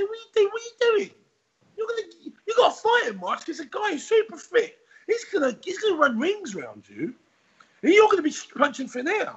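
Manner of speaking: high, shouting trainer telling him off; tough trainer. Retold or imitated. Trainer scolding the lazy fighter, high and shouting. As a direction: bark at him like a Rocky coach. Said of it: "It was like a Rocky movie".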